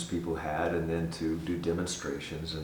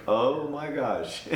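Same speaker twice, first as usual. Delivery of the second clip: drawn-out, higher mock dismay; self-conscious dismay; 6 semitones higher, much slower than usual. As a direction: groan it slowly, retelling your embarrassment. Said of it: "Very self-conscious".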